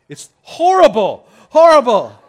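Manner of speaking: comic mock despair over broken seat warmers, high and loud; mock outrage. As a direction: overplay the tragedy of cold seats.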